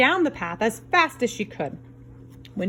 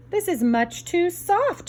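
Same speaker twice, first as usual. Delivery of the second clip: Goldilocks voice; 9 semitones higher, slower than usual. Acted. Goldilocks in bed.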